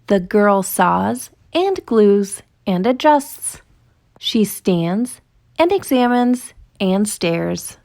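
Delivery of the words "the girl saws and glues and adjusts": usual speaking voice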